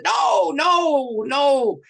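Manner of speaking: retold shout. Preacher cries out, interrupting sermon.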